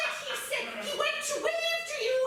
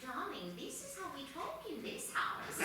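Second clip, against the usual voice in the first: much lower, softer creepy voice; creepy librarian; 9 semitones lower, 12 dB quieter than usual. Acted. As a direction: murmur it like a haunted host. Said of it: "talk to me in a typical voice".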